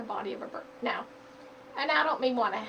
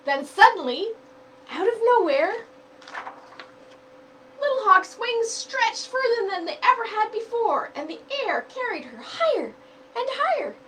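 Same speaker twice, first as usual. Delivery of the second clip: lively storybook narration, higher and louder; reading to children; 5 semitones higher, 10 dB louder than usual. Acted. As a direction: read it aloud like a picture book for kids. Reads picture book aloud.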